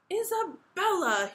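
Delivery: high, loud, drawn-out name; long-suffering father, stretched out; exasperated sigh. A narrator's reading. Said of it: "he sighed".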